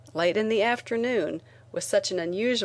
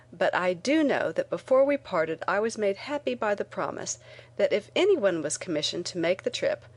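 usual speaking voice

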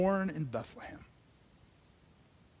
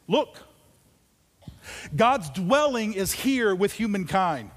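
loud, high declamatory reading; fervent scripture reading, fading on the last words; proclaiming scripture; 4 semitones higher, 7 dB louder than usual. Acted. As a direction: proclaim the promise over the room. Reading Revelation aloud.

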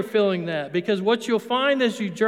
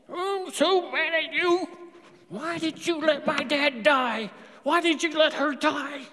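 anguished lament; 6 semitones higher than usual. Pretend. Grieving believer confronting God over deaths, anguished. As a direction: cry out your loss to God. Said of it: "I can beat on his chest".

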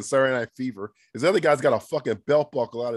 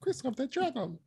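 mock film voice; 11 semitones higher, 9 dB quieter than usual. Echoes title in put-on voice.

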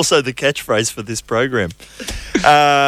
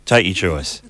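normal talking voice

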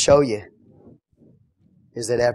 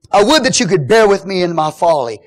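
louder, higher mock plea; sarcastic; 7 semitones higher, 9 dB louder than usual. Paul to those putting up with him, sarcastic. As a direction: plead with mild sarcasm.

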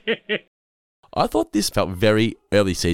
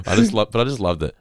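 ordinary talk

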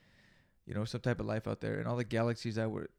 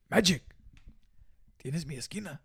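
movie quote; 6 semitones higher than usual. Retold or imitated. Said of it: "or some shit like that".

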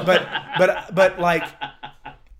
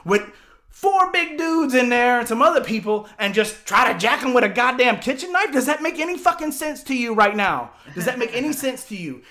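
loud, much higher disbelieving rant; heated rant; 8 semitones higher, 7 dB louder than usual. Retold or imitated. Teller ranting at the men, loud, high and exasperated. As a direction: hammer the absurdity loud and high.

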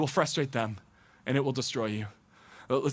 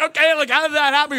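higher, louder put-on questioning voice; mock questioning; 9 semitones higher, 8 dB louder than usual. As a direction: play the skeptic, loud and high. Mocking listener's questioning voice.

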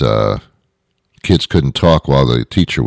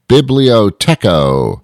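higher, drawn-out jokey answer; class-clown wisecrack; 7 semitones higher, 3 dB louder, slower than usual. Retold or imitated. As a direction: call it out slowly like the class clown. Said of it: "raise my hand and I'd say".

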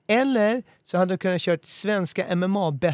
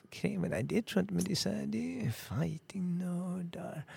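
hushed; 12 dB quieter than usual. Acted. Secretive joke name suggestion.

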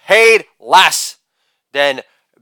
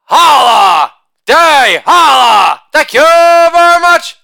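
loud sing-song holiday chant; shouted catchphrase; 6 semitones higher, 3 dB louder than usual. Acted. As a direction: belt the tag line like a party cheer. Catchphrase chant after each joke.

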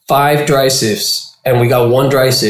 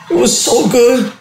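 tearful gush; 11 semitones higher than usual. Retold or imitated. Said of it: "tears are coming out".

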